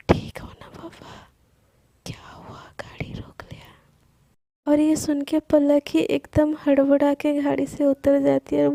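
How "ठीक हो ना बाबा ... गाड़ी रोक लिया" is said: slow, soft teasing whisper. A narrator's reading. Sana teases flustered Palak in a slow whisper. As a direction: whisper it slowly to fluster her. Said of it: "धीरे क्यों बोल रही हो".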